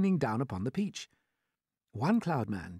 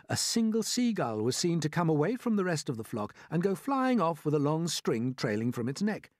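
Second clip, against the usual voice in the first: ordinary delivery, nothing marked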